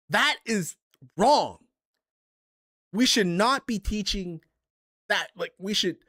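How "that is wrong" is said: slowly, every word weighted, landing hard on wrong; slow emphatic verdict. Real, not acted. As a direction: lay down the law on wrong, spaced out. Scolding gamers who turn toxic.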